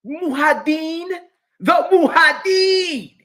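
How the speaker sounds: high, slow, loud mock Arabic "Muhaddin"; shouted parody Arabic accent. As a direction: belt it out like a hammed-up Arabic speaker.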